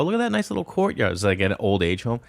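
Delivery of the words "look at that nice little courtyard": much higher, cheerful talking-to-elder voice; brightly, like to a grandma; high sweet voice. Retold or imitated. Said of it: "And I go".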